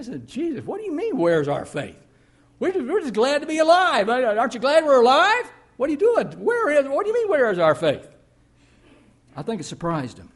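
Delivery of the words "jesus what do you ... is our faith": a high, loud, bewildered protest; indignant outcry. Acted. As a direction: shout back at Jesus, shocked and offended. Disciples answer Jesus, bewildered.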